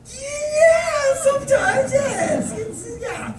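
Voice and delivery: very high, squeaky hedge; hedging. Retold or imitated. Tourist answers praise of America, squeakily hedging.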